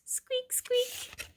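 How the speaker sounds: very high, soft mouse squeaks. Acted. Mouse noise voiced.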